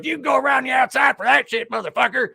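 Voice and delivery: high, loud mock shout. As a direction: yell it through the door. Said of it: "And he goes".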